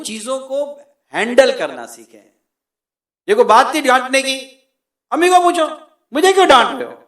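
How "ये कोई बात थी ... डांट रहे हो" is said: loud, high, indignant back-talk; raised voice. Acted. Son answering back to his scolding father, raised indignant voice. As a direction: protest at full voice, pitch right up. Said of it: "अपने वालिद से ऊंची आवाज में बात करे".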